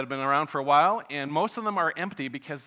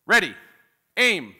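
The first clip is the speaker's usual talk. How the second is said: higher, louder orders building to a yell; shouted commands; 6 semitones higher, 9 dB louder than usual. Acted. Executioner to firing squad, prisoner breaks in, shouted. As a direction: bark the orders, then burst out with the last word. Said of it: "shouts the player".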